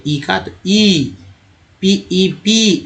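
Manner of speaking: "पी ई पी" spelled letter by letter, slowly; spelling out slowly. Shown as a demonstration. Teacher spelling "पी ई पी" slowly for students. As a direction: drag out "ई" long and slow. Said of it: "ई को ई बोलते हैं".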